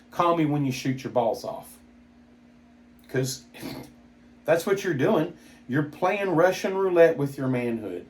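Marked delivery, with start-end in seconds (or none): none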